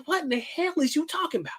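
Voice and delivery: incredulous. Retold or imitated. Repeats his retort.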